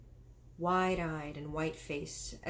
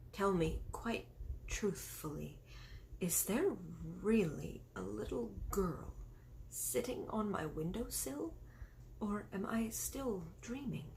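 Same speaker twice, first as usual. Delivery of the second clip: a soft, breathless near-whisper, fading to unvoiced; strangled whisper; 2 semitones higher, 6 dB quieter than usual. A narrator's reading. The shocked queen quietly asks her maid if she is dreaming, whispering. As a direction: barely get the words out. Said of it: "strangled sort of whisper".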